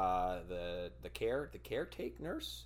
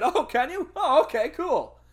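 much higher and louder mock "okay, cool"; sarcastic comeback; 12 dB louder than usual. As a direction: sneer it back sarcastically.

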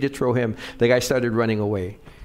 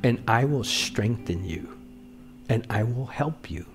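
scripture reading; 2 semitones lower, 4 dB quieter than usual. Acted. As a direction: read it gently, as God speaking comfort. Reads God's promise softly.

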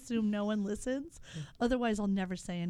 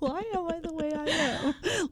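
laughing exasperation; 6 semitones higher than usual. Real, not acted. Laughing at own flub.

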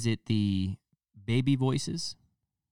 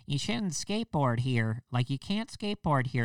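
mock scolding lady; 7 semitones higher than usual. Busybody lady scolding skaters in a skate park. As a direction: scold like a self-important busybody. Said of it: "ladies like".

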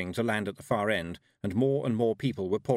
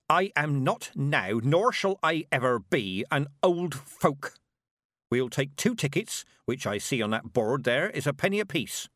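indignant Granny; 5 semitones higher, 3 dB louder than usual. Acted. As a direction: lay down each word like law. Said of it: "said Granny".

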